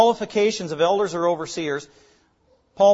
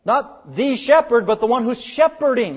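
"the" drawn out as THEE, then "shepherding" punched; contrastive stress; 5 semitones higher, 6 dB louder than usual. Shown as a demonstration. Preacher contrasting noun and verb, stress on "the" and "shepherding". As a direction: set "the" against "shepherding", higher and louder. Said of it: "That's the emphasis in the local church".

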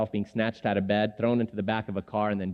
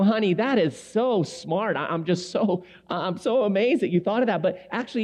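gentle parent; 7 semitones higher than usual. Retold or imitated. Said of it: "I go".

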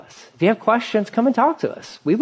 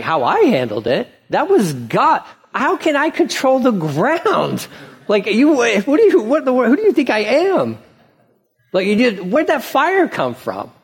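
Moses, pitched higher and incredulous; exasperated Moses; 6 semitones higher than usual. Retold or imitated. Moses defending himself to the grumbling crowd, exasperated. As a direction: throw up your hands, how is this my fault. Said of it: "He's like".

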